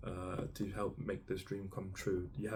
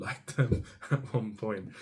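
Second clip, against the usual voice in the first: laughing; 2 semitones higher, 7 dB louder than usual. Real, not acted. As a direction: break into a laugh mid-sentence. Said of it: "I'm laughing".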